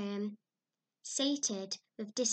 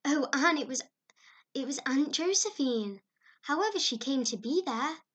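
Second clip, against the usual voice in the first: higher, louder giggling excitement; bursting with suppressed laughter; gasping with laughter; 4 semitones higher, 6 dB louder than usual. A narrator's reading. Diana telling Anne about the aunt, laughing excitedly. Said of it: "gasping with laughter".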